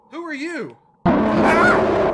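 high, loud panicked shriek; real fright, full shriek; startled scream. Real, not acted. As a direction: scream as something jumps out. Jump scare in horror game.

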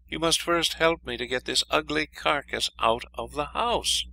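high princess voice. A narrator's reading. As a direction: ask it in a high voice. Princess asks for help.

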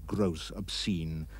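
ordinary delivery

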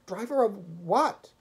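high, loud, drawn-out question; feverish, bewildered cry; chattering. A narrator's reading. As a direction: demand it in rising panic. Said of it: "he chattered".

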